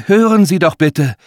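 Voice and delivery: high, loud appeal; urgent plea. A narrator's reading. Woman trying to calm the old man, pleading loudly. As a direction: beg him to listen. Mila pleads with angry executor.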